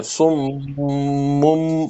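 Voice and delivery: سمم with long nasal mim; held ghunna. Shown as a demonstration. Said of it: "میم سے بدلنا ہے". (سمم میں میم پر لمبا غنہ)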